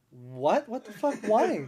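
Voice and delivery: rising alarmed what the fuck; shocked disbelief. Retold or imitated. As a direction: react in sudden shock. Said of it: "And I'm like".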